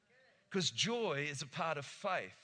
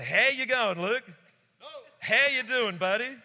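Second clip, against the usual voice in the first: loud, high friendly hello; cheery greeting; 6 semitones higher, 9 dB louder than usual. Retold or imitated. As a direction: call out warmly to a buddy. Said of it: "God is just going".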